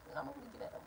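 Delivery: whisper. Real, not acted. Threat said under breath.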